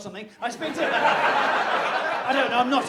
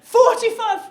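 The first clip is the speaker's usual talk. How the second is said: laughing; 12 semitones higher, 11 dB louder, slower than usual. Real, not acted.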